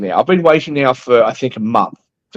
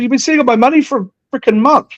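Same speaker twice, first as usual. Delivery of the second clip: high, fed-up retold snap; exasperated retort; 11 semitones higher than usual. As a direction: throw it back at the bank clerk, pitched up. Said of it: "I said".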